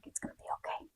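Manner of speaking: whispered comfort to a crying stranger; soft whisper. Retold or imitated. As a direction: breathe it gently, barely voiced. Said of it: "and she's like".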